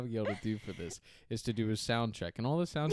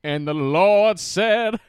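slow, booming preacher voice, much louder and higher; drawn-out pulpit proclamation, hammed-up; mock preacher; 7 semitones higher, 13 dB louder, much slower than usual. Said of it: "He looks like a preacher right here".

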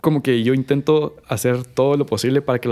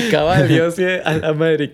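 words squeezed out through laughter, a bit higher; laughing; 4 semitones higher, 3 dB louder, slower than usual. Real, not acted. Agreeing with a friend while still laughing, laughing.

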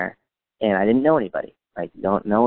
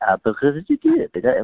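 high-pitched mock-French babble; pretend French; 8 semitones higher than usual. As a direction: put on a made-up French accent. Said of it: "we'd pretend we were French".

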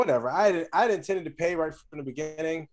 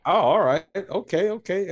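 normal talking voice

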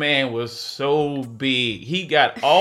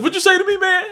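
much higher, louder challenge; a driver squaring up at a kid; mock outraged bus driver; angry confrontation; 5 dB louder, faster than usual.

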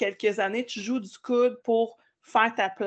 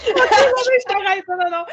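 laughing protest; 11 semitones higher, 11 dB louder than usual. Real, not acted. Host laughs, cuts guest off.

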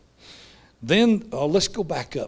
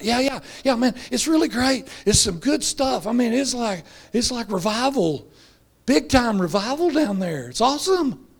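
high, excited and breathless praise; gushing disciples; 8 semitones higher than usual. Retold or imitated. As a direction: sell it like the best news ever. Disciples gush about revival.

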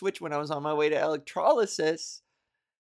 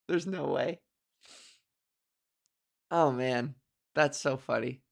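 normal talking voice

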